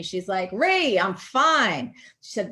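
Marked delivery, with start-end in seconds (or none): 0.5-1.9 put-on loud, drawn-out call down to a child; mom yelling down. Mom up on the roof to her child, yelling down. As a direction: holler it slowly, carrying from above. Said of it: "my mom yells down to me".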